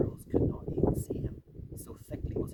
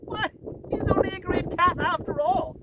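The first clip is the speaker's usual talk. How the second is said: a higher, louder sneering creature voice; mocking creature cry; 5 dB louder than usual. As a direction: crow it at the fallen lion with scorn. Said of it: "cried one".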